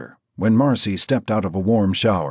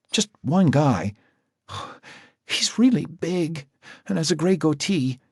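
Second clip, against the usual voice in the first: put-on sad frightened girl; 10 semitones higher than usual. Marcy describing the biker to Jack, high and weary. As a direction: tell it wearily with dread.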